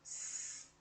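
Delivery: long unvoiced "S" hiss; isolated S sound. Shown as a demonstration. Teacher showing the class the sound of S, isolated hiss. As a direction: sustain "S" as a bare hiss, no vowel. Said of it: "o som do S".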